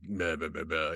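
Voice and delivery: low, bored mumbled meh; dismissive tough guy. Retold or imitated. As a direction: shrug it off like a tough guy leaving. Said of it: "that's my impression of that character".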